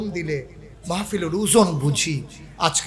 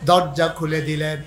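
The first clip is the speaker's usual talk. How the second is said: usual speaking voice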